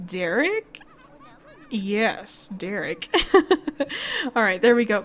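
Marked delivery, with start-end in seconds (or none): 3.1-4.3 bursting high giggle; high laugh. Real, not acted. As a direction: laugh out at the mess. Laughs at the chaos.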